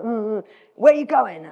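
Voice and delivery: lightly voiced old local, sizing up a stranger; grizzly old Alaskan. Old Alaskan asks the London rider her destination, lightly imitated grizzly local. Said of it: "this grizzly old Alaskan guy".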